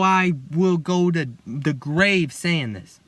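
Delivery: very slow, every word weighed; slow it right down like a vow; solemn vow. Real, not acted. Solemn vow about his belief.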